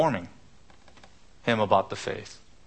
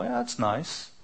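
higher, softer offhand "yeah"; dismissive shrug; 6 semitones higher, 5 dB quieter than usual. Pretend. Preacher mocking a hearer who shrugs off truth, offhand. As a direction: brush it off like small talk. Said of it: "hear truth and go".

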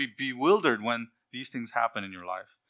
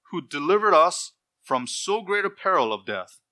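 preaching at full force; 6 semitones higher, 6 dB louder than usual. Acted. Preacher proclaims scripture verse.

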